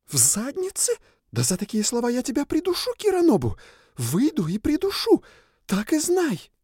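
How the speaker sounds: high furious threatening spirit voice; разъярённая сова. Acted. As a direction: shriek threats at him. Owl spirit threatens him, furious. (высокий яростный крик духа)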